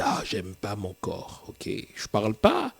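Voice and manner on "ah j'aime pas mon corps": soft, sighing mock lament; quiet imitated grumble about one's body; imitated self-complaint. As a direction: mutter it like someone unhappy with their body. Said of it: "c'est vous-même qui dites".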